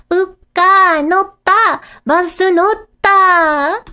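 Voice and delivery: put-on high, loud, slow little-girl announcement; proud child voice. Child telling grandpa she rode the bus, high and proud. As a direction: announce it slowly, proud and winded.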